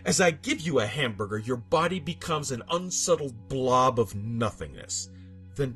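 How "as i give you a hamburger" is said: usual speaking voice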